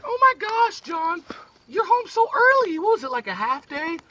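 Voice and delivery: high, loud fussy mother voice; gushing mom surprised at the door. Acted. Mom surprised he's home early.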